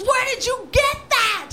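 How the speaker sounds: high, strained furious yell; imitated apoplectic shouting; about to pop a vein. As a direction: scream it like an enraged warden. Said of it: "he's about to pop a vein".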